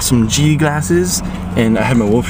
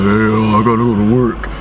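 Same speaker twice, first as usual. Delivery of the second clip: deep jock voice. Retold or imitated. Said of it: "her voice got all deep like this".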